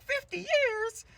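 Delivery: very high, loud, drawn-out disbelief; shocked girls. Acted. Girls reacting to the teacher's news, high stunned squeal. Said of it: "And they're like".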